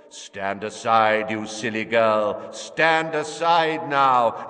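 slow, menacing villain voice; cold menacing voice. Acted. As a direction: threaten her, cold and slow. Villain threatens the woman.

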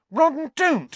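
put-on girl angrily stopping her friend; angry. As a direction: stop him with an angry shout. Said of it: "said Hermione angrily".

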